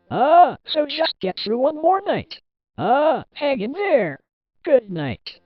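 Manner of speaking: high, loud pep talk; rally the listener, pitched well up; encouraging phone caller. Acted. Phone caller encourages night guard.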